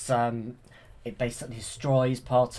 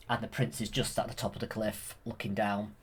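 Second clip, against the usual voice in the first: ordinary talk, nothing marked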